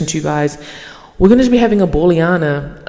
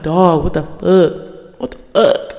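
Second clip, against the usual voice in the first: slow, drawn-out disbelief, then a breathy repeat; exasperated disbelief; slower than usual. Retold or imitated. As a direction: stretch it out, stunned by the traffic. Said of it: "And I'm like".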